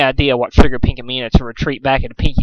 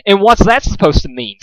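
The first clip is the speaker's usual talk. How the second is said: snapped; 7 semitones higher than usual. A narrator's reading. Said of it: "snapped Rarity".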